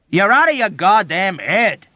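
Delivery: high, loud, forceful; emphatic shout. A narrator's reading. Soldier shouting at the doctor, gripping his shirt. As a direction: shout it right in his face. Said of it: "shouted at him emphatically".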